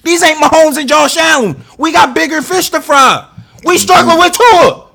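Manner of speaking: high, loud, incredulous yelling; outraged rant. Real, not acted. Fan fuming about struggling against weak quarterbacks, high outraged shout.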